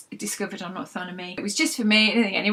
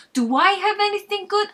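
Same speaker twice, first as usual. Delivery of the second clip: even higher, louder repeat, hammed-up indignation; more outraged Frank; 12 semitones higher, 6 dB louder than usual. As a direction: push the fake outrage further. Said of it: "feigned offense".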